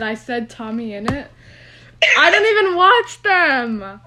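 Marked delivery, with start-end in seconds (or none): 2.2-4.0 higher, louder and slow, mock-horrified; laughing self-reproach, loud; embarrassed outburst. Real, not acted. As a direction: blurt it out in embarrassment.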